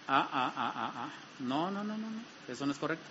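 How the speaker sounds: low, hushed, fading no-no-no; a quiet inner voice tutting you away from sin; gentle inner warning voice. Acted. Preacher voicing the Holy Spirit's warning inside you, hushed and low.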